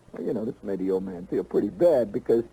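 normal talking voice

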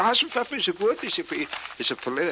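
high, stumbling fake German; mock German accent. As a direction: stammer through it in German accent. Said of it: "everything I say is in a German accent".

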